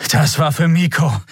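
außer Atem, keuchend gesprochen; schwer atmend. Acted. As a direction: sag es noch außer Atem vom Kampf. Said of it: "erklärte Kai schwer atmend". (schwer atmend, außer Atem)

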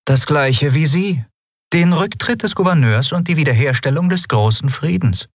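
calm, polite ruler holding back a secret; lightly voiced empress. A narrator's reading.

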